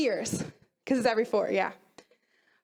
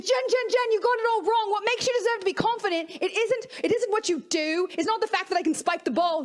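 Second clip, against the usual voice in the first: put-on high-pitched, intense Aussie accent; emphatic mock-Australian voice, pitched up; 5 semitones higher than usual. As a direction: imitate a crazy, super-intense Australian athlete. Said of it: "Super intense".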